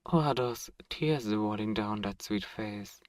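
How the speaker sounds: ordinary talk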